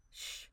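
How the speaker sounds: soft, unvoiced hushing; shushing. A narrator's reading. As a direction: hush him gently, finger to his lips. Finger on his lips.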